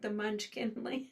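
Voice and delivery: soft words breaking into a laugh; amused laughing. Real, not acted. Host fumbling for a film name, laughing softly.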